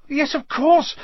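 high-pitched fluster; panicked cry. A narrator's reading. Hermione frets, wringing her hands, crying out. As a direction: cry it out in helpless alarm. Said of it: "Hermione cried".

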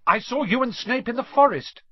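put-on high, loud blurt of a frightened boy; Harry blurting at Quirrell, sudden and high. A narrator's reading. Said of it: "he blurted out".

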